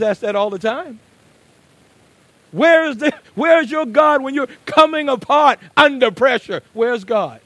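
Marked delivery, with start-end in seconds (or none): 2.5-7.4 loud, high, ringing pulpit delivery; preaching at full force. Acted. Preacher voicing the psalmist's plea, loud and ringing. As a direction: cry it out to the whole church.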